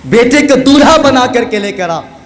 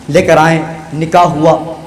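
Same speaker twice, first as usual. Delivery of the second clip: ordinary talk, nothing marked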